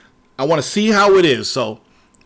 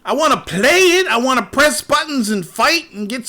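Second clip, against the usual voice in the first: higher, pleading frustration; whiny protest; 7 semitones higher, 3 dB louder than usual. Retold or imitated. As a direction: whine that you just want to play. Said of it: "And I'm like".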